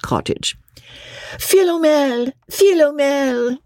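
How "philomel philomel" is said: put-on high, drawn-out old woman's call; high slow crone call. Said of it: "cries the old woman".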